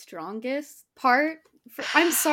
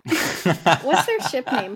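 laughing; 3 semitones lower, 4 dB louder, much slower than usual. Real, not acted. Teasing about a cat pairing.

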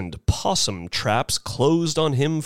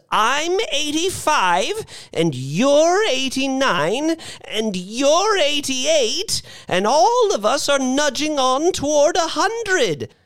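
put-on high, impassioned old-woman speech; rally them in a high elderly voice; determined old lady; 12 semitones higher than usual. A narrator's reading. Missus Bellows rallying everyone to take a chance, determined.

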